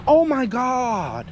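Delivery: high, long-drawn amazement; real drawn-out astonishment; amazed exclamation. Real, not acted. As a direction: stretch it out slowly in high disbelief. Reacts to the dog's performance.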